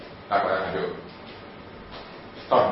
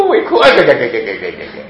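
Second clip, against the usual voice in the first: mock child wail, granny giving in; 9 dB louder than usual. Grandma caving to a wailing grandchild, mock giving. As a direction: play the spoiled grandchild crying, then grandma caving.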